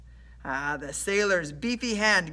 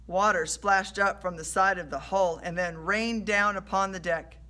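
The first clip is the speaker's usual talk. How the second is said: ordinary delivery, nothing marked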